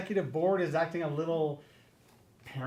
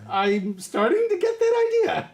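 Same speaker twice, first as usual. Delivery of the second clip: nervous squeak; 12 semitones higher, 5 dB louder than usual. Acted. Nervous reply to boss.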